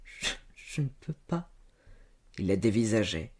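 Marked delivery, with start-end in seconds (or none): 0.0-1.5 weak, breath-choked halting whimper; tearful repeat. A narrator's reading. Servant girl refusing her master, crying. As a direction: stammer it softly through tears. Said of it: "Elle se remit à pleurer et répéta".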